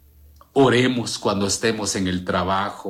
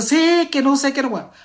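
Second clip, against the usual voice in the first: high scolding mock shout; shrill-free mock scold, pitched high; mock nagging wife; 5 dB louder than usual. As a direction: play the wife scolding her late husband. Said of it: "Y la esposa".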